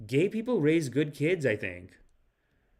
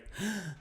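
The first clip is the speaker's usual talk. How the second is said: sharp, high intake of breath; mimicked gasp; 5 semitones higher, 10 dB quieter than usual. Comedian mimics leader between sentences, winded gasp. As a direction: suck in air as if winded. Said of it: "between every sentence, he's like".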